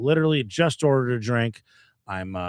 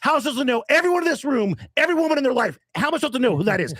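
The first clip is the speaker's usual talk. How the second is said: higher, fast, challenging demands; indignant rant; 10 semitones higher, faster than usual. Retold or imitated. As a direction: fire questions back in mock outrage. Retells heated rant at stranger.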